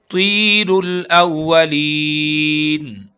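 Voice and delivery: तीरुल with a heavy ता, very slow and stretched; heavy drawn ता. Shown as a demonstration. Teacher repeats the word for students, slow heavy chant. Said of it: "तौ को मोटा पढ़ेंगे".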